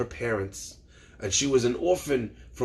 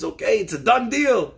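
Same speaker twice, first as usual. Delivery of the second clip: higher, animated retold reply; upbeat agreement; 7 semitones higher, 4 dB louder than usual. Rabbi welcoming his newly adopted daughter, upbeat. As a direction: seal the deal with brisk enthusiasm.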